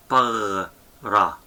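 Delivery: "Pagra" in two pieces, gargled "pagh" then "ra"; slow syllable demo; soft Gamal, split. Shown as a demonstration. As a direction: pause inside "Pagra" after the throaty g. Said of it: "For example".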